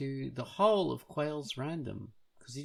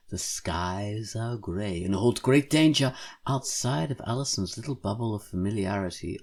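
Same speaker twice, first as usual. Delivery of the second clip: dramatic narration; 6 semitones lower, 3 dB louder than usual. Acted. Dramatic comic blurb.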